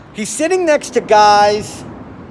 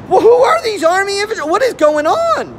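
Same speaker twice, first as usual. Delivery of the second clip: baffled; 9 semitones higher than usual. Real, not acted. Baffled rhetorical questions.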